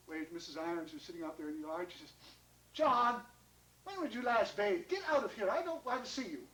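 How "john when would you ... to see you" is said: haughty scolding. Acted. Missus Irons shoos John from her yard, scolding. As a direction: send them away in disgust.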